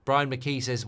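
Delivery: ordinary talk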